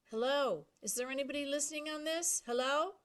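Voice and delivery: loud, high calls into the mic; calling out. Acted. Boy testing the radio in a cockpit, calling out loudly. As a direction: call out, hoping someone answers.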